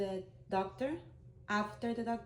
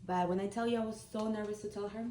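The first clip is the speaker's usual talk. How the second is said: ordinary talk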